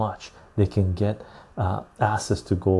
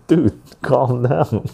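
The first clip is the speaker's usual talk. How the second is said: slow mock calm-down; 2 semitones higher, 3 dB louder, much slower than usual. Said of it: "It's like".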